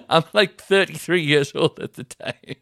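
laughing hard through the words; can barely get it out for laughing. Real, not acted. Telling mates about the chase, laughing his head off.

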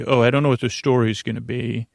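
ordinary talk

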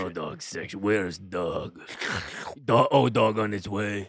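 put-on light Russian accent, dropped articles; a hint of a Russian gate agent; mock Russian accent, cohost joining the bit. Joint Russian bouncer bit.

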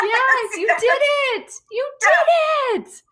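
high cheering praise; praising a proud friend, high cheer; mock praise. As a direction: cheer him on like a proud parent. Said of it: "someone to be like".

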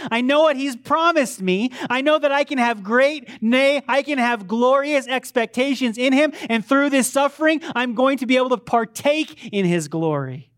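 loud, high preaching build. Acted. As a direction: preach it with fire to the congregation.

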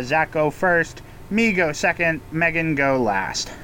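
normal talking voice

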